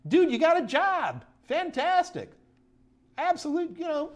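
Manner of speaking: real thrill for a viewer's new job, voice soaring up; excited congratulations. Real, not acted.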